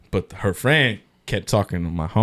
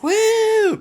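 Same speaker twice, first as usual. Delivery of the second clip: loud, much higher whoop; cheering woo; 6 dB louder than usual. Retold or imitated. Friends cheer at the club, loud high whoop. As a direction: whoop like a party crowd.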